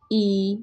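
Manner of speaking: drawn-out, level ee; long ee vowel. Shown as a demonstration. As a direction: hold the ee sound long. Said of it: "इसको हमने लंबा करना है".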